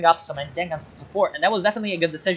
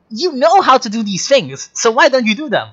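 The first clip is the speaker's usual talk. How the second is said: higher and louder real exasperation; louder, higher annoyed rant; frustrated rant; 7 semitones higher, 6 dB louder than usual. Real, not acted. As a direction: demand an answer from the player, annoyed. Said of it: "I'm so mad right now".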